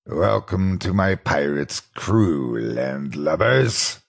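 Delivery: slow, drawn-out pirate greeting. Acted. Animatronic fox greeting the narrator, slow pirate voice. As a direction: greet like an old robotic pirate, slowly. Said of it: "repeating his skit to me slowly".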